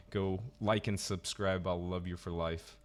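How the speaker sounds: normal talking voice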